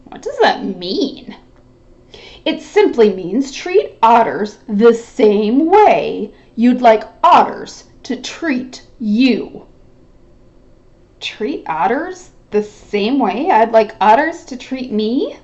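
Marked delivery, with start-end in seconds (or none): 2.4-9.7 slow, patient teaching tone; patient explanation. Acted. Otter explains the saying slowly. 11.2-15.4 turning the words over in his head; puzzled repeat. Acted. Rabbit repeats the saying puzzled.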